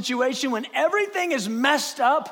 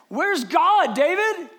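raised mock challenge; taunting critic; 7 semitones higher, 5 dB louder, slower than usual. Men in his own camp assailing David, taunting. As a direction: call David out.

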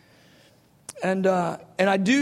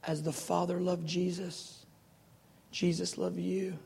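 much softer, hushed; 10 dB quieter than usual. Acted. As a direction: say it quietly, tenderly. Soft closing.

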